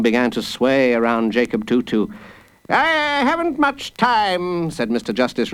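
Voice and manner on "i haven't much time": high, slow, pompous judge; impatient judge. Acted. Judge hurrying a child's case along, pompous. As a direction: draw it out high with self-importance.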